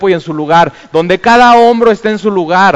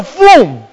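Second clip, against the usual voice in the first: fum said high and loud, like a launch; ride whoosh sound; 9 semitones higher, 5 dB louder than usual. Retold or imitated. Preacher mimics the ride shooting off, whooshing. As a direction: throw out a sudden whoosh.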